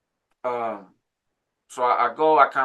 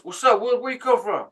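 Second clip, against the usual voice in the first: much higher, louder retold hail; calling out; 11 semitones higher, 5 dB louder than usual. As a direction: imitate a guy calling after a newcomer. Strangers call out to him.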